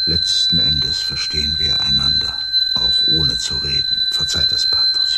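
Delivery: speaking over the din. Acted. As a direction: raise your voices over the roaring tone. Shouting over the swelling tone.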